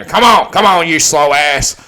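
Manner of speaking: high, loud urging; retold yell. Father urges the horse from the stands, imitated yell. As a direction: holler it to spur a horse on. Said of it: "just yell".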